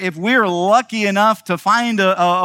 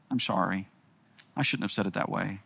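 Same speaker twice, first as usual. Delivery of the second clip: much softer, contrite; soft apology; 9 semitones lower, 11 dB quieter, faster than usual. Retold or imitated. Husband admitting to his wife he spoke too harshly, softly. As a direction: say sorry gently, almost under your breath.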